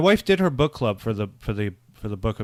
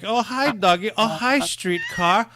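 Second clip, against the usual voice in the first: high, drawn-out greetings in a mimicked weird accent; playful high-pitched accent imitation, stretching the words; Tommy Wiseau accent; 10 semitones higher, slower than usual. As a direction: parody the odd movie accent, pitched way up.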